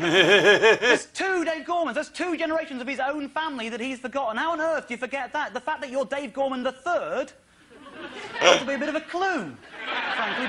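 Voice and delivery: high-pitched, exasperated comic rant; incredulous rant. Real, not acted. Comic to audience about forgotten relatives, incredulous. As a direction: rant in sheer disbelief, pitch held high.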